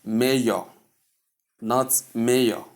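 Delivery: "mayor" as two beats, "may-yo"; "mayor" ending in an O sound; wrong mayor, may-yo. Shown as a demonstration. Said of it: "as some people may call it".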